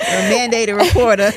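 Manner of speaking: laughing tease; amused teasing. Real, not acted. Friend teases the cool aunt about telling, amused teasing.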